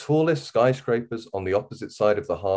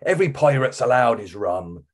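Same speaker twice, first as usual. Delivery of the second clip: pirate yell; 3 semitones higher, 4 dB louder than usual. A narrator's reading. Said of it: "Paul yelled".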